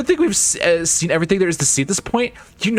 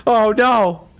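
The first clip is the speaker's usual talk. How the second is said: excited; 6 semitones higher than usual. Real, not acted. Excited reaction to game death.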